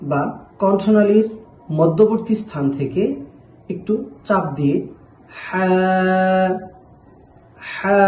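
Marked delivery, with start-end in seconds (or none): 5.4-8.0 হা pressed from the middle of the throat; teacher modelling হা; throat letter demo. Shown as a demonstration. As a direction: push হা out with a little throat pressure. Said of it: "এভাবে উচ্চারণ করতে হবে".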